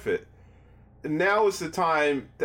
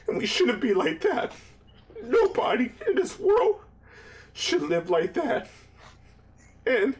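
crying; 10 semitones higher than usual. Real, not acted. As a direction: voice cracking high with real tears. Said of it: "here comes the tear works".

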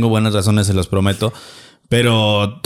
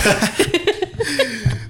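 high giggle; 11 semitones higher, 4 dB quieter than usual. Real, not acted. Laughing at ex story.

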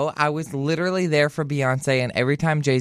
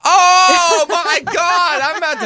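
much higher, louder "oh my God"; shocked outburst; 13 semitones higher, 8 dB louder, slower than usual. Real, not acted. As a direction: gasp it out in disbelief. Reacts to friend's cheeky remark.

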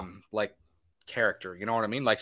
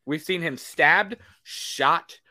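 usual speaking voice

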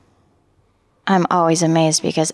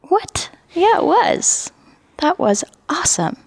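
much higher, incredulous What then defiant yeah; surprised protest against her own critic; pitched-up disbelief turning proud; indignant surprise; 11 semitones higher than usual. Retold or imitated. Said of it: "I thought".

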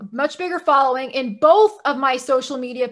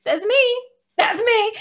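emphatic high insistence; 9 semitones higher than usual. Retold or imitated. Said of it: "I'm like".

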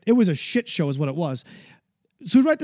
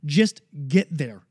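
"just get there" drawn out slowly, each word weighted; slow it right down to drive it home; slow and emphatic; 5 semitones lower, much slower than usual. Real, not acted. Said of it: "I don't care how you get there".

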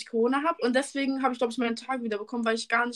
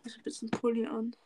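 soft unvoiced whisper; 8 dB quieter, faster than usual. Real, not acted. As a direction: whisper it under your breath. Quiet aside.

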